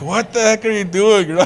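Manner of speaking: "What the heck are you doing?" pitched high in disbelief; mock outrage, high and incredulous; exasperated. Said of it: "And I'm like".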